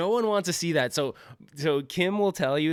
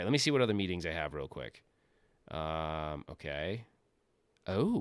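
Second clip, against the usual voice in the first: normal talking voice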